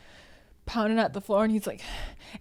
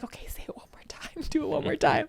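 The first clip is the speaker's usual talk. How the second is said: giggling whisper egging on; hushed giggly coaxing; 7 semitones higher, 6 dB quieter than usual. Retold or imitated. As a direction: whisper it, half laughing. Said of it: "And we're like".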